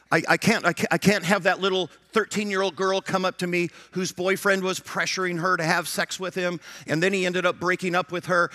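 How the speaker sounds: ordinary talk, nothing marked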